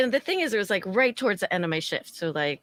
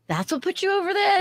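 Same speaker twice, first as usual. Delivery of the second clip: incredulous; 12 semitones higher than usual. Retold or imitated. Incredulous question.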